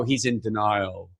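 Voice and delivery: low, slow knowing verdict; smug skeptic. Retold or imitated. Imagining the audience judging him, low and slow. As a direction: drawl it like a know-it-all. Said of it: "gonna go".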